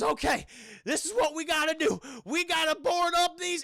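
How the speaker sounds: high, loud urgent command; rally everyone to board up fast; excited urgent plan. Retold or imitated. Retelling Ash's urgent plan.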